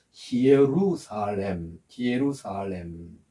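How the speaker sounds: 히에루살렘 very slowly, "hi-e-RU-sa-lem"; Greek pronunciation. Shown as a demonstration. Preacher reading out the second Greek name, 히에루살렘 slowly sounded out. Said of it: "이 발음을 정확하게 하면".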